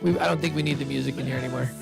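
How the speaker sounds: ordinary delivery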